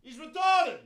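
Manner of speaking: shouted high out the van door to a partner; calling out. Retold or imitated. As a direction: yell it across to Tony outside. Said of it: "leans out the van door and he goes".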